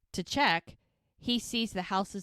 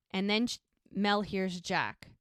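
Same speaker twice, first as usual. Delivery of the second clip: normal talking voice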